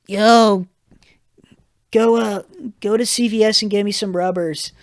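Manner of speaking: hint of a drunk buddy; drunk friend voice.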